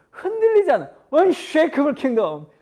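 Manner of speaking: bursting with joy. Real, not acted. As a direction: proclaim it with a full heart. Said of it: "가슴 벅차지 않아". (벅차오르는 감격으로 높고 크게)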